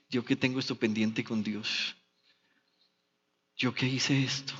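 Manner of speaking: a hushed, worried murmur to oneself; frightened inner voice. Retold or imitated. Sinner fearing God over an unpaid debt, hushed and worried.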